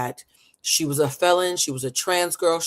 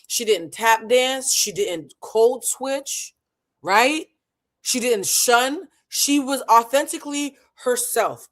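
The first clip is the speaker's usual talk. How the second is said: higher, emphatic, slowed punch on each clause; fired up; 6 semitones higher, 4 dB louder than usual. Real, not acted. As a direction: hammer each "she didn't" home. Heated point about resentment.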